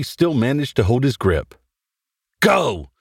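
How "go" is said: restrained narrator's version of a scream; urgent order, only mildly screamed; narrated scream. A narrator's reading. Tony orders friends out during the fight, mild acted scream. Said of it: "Tony screamed".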